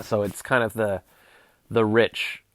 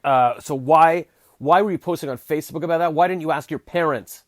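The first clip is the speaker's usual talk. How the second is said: imitating dad; 7 semitones higher, 9 dB louder than usual. Dad scolding about Facebook post.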